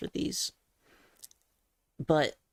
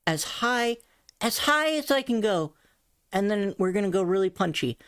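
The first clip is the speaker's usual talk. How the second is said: usual speaking voice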